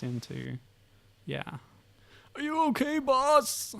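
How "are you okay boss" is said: put-on high, loud wobbly old man voice; a creaky old geezer calling out. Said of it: "That was my old man voice".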